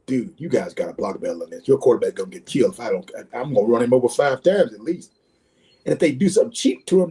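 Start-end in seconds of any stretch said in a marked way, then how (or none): 0.1-5.1 low-pitched warning to teammates; retold stern warning. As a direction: imitate a veteran laying down the law. Retelling Lawrence warning his linemen.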